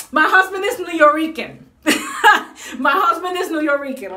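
laughing through the words; giggling as she says it. Real, not acted. Vlogger laughing while telling viewers about her husband.

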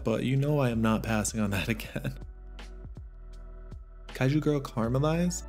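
ordinary talk, nothing marked

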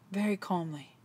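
low, hushed aside; very calm. Shown as a demonstration. Preacher describing Jesus's last words, very calmly. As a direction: drop to a soft, low murmur.

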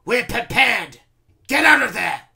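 high, loud commander's yell; shouting. A narrator's reading. Randolph ordering the gatekeeper away, shouting. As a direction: yell the man out of danger. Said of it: "Randolph shouted".

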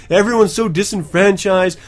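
ordinary delivery

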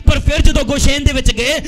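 loud preacher in full flow; full-force preaching. Real, not acted. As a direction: preach in insistent bursts, building to the last words.

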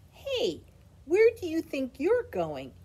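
put-on high, loud, scolding kid voice; a boy's outraged high yell; indignant child shout. A narrator's reading. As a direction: call out a runaway pair of pants. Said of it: "he said to his pants".

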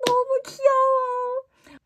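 very high, slow, stretched squeal; cutesy squeal. Real, not acted. Fan cooing over a photo, high squeal. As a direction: coo like it's unbearably cute.

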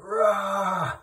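sudden loud angry yell of "Rah"; frustrated shout, loud and sharp; retold scream. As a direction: let out one short raging roar. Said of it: "I just screamed".

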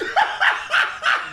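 loud, high burst of laughter; incredulous laugh. Real, not acted. Player reacts to a bullshit moment, incredulous laughing. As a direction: crack up in disbelief. Disbelief at what just happened.

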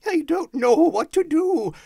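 very high, loud, hysterical joy; beside himself with joy; giddy, overwhelmed cry. A narrator's reading. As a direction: cry out between laughter and tears. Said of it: "laughing and crying in the same breath".

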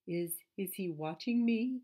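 lightly voiced nervous rabbit, tripping on the first word; scared rabbit. A narrator's reading. Rabbit worried the stranger is watching, mildly scared. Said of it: "said the scared rabbit".

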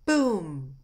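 loud, booming impact; explosive boom effect; imitated boom. Shown as a demonstration. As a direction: let the word burst and fall away. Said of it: "Impact noises".

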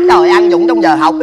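high, loud shocked outburst; outraged exclamation. Acted. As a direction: gasp it out in disbelief. Father outraged at report card.

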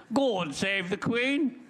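stiff-upper-lip farewell; God save the Queen with slow, high pomp; patriotic Englishman. Retold or imitated. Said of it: "I too am not going to die".

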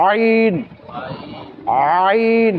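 আইন begun from a squeezed mid-throat; long, drawn-out আইন with the throat pressed; pressed throat sound. Shown as a demonstration. Teacher shows learners the Arabic letter, pressed-throat আইন. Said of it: "হলকটাকে মধ্যখানে একটু চাপ দিয়ে উচ্চারণ".

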